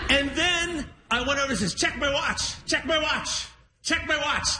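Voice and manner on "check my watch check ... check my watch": higher-pitched, repeated nagging demand; insistent urging. Retold or imitated. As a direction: pester with the same eager line. Retold eager demand, thrice repeated.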